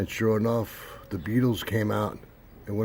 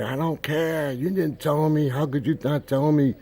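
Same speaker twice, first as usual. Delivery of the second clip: high, loud tearful wail; imitated crying; 6 semitones higher, 6 dB louder than usual. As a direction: mimic a hysterical girl wailing an accusation. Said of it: "And she's crying, saying".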